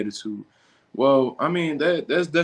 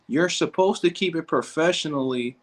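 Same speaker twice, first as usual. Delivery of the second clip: higher put-on prissy read of professionally; mock prim voice; 4 semitones higher than usual. Streamer quoting the coach's comment in a mocking prissy voice. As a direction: read the line back like a stuffy critic.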